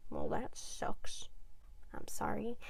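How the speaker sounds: soft unvoiced whisper of sorry; whispered sympathy. Telling her boyfriend sorry in a whisper.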